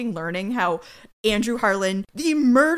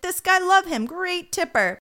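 high, eager doorman voice; gushing doorman; 9 semitones higher, 3 dB louder than usual. Retold or imitated. Doorman shown a photo, high eager gushing. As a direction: chirp it like a fan.

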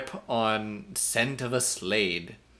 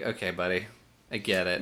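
dry patronizing; 4 semitones lower, 6 dB quieter, faster than usual. Retold or imitated. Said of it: "I'm like".

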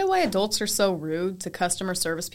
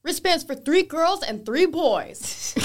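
higher, slow, dumb-sounding customer order; mocking customer; 7 semitones higher, slower than usual. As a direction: parody a clueless parent. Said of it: "big families being like".

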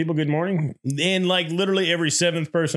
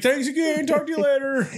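high, sweet mock politeness; 11 semitones higher than usual. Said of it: "he's like".

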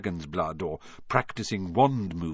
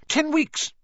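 sharp snapping reply; snapped; 4 dB louder than usual. A narrator's reading. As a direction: snap it back, high and curt. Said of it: "Hermione snapped".

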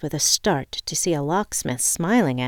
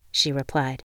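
usual speaking voice

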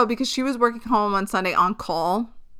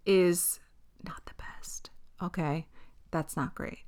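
whispered aside; 3 semitones lower, 9 dB quieter than usual. Real, not acted. Whispering to listeners about her failing meds. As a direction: drop to an unvoiced whisper.

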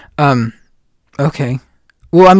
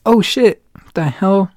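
mock shock; 5 semitones higher, slower than usual. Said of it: "I was like".

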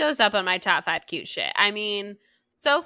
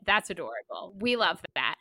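ordinary delivery

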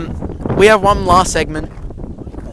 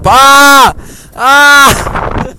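high loud yelp of fright; startled shriek; 10 semitones higher, 5 dB louder than usual. Real, not acted. Friends on a spinning roundabout, high startled yelp.

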